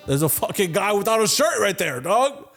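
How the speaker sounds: high-pitched, outraged arguing; indignant protest. Retold or imitated. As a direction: argue back with your pitch shooting way up. Arguing with Walmart security.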